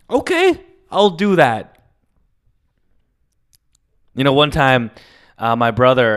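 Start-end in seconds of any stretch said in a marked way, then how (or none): 0.1-1.8 mock compliant reply. Own reply to grandmother.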